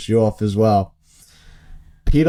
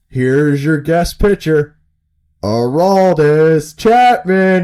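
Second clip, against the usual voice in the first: louder, higher, slow ring announcer intro; mock announcer; 6 semitones higher, 6 dB louder, much slower than usual. Announcer introducing a surprise guest pitcher, drawn-out and loud. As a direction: boom out the name like a stadium announcer.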